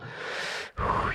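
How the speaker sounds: soft, breathy exhale; flustered sigh. Real, not acted. Flustered by being looked at.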